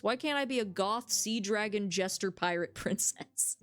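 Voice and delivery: ordinary talk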